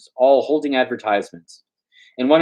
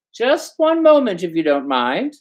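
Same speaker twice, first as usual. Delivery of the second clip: lightly voiced clerk; 10 semitones higher than usual.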